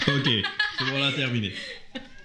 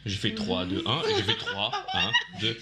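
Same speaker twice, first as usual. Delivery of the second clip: laughing; 4 semitones lower than usual. Real, not acted. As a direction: laugh through the counting. Recounting the game, laughing.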